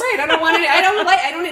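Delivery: laughing, high and loud protest. Real, not acted. Laughing to her cohost about feelings, laughing protest.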